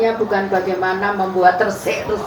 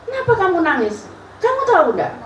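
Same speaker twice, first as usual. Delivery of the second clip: much higher voice for the pastor; pastor's voice; 9 semitones higher, faster than usual. Retold or imitated. The pastor asks why she keeps crying, high-pitched. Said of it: "quoting the pastor".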